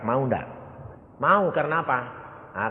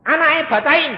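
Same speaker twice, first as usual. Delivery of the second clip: sharp order; 14 dB louder than usual. Retold or imitated. Preacher voices quick order.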